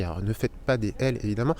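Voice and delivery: usual speaking voice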